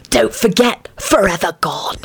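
understated dark drama; dark hiss. Acted. Sirius reminds the group of the lament, mock-dark. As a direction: add it with mock gravity. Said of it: "Sirius hissed darkly".

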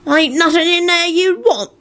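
high-pitched, aggrieved rogue; defensive grumble in character; grumbling. A narrator's reading. Mundungus accusing Neville, grumbling. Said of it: "Mundungus grumbled".

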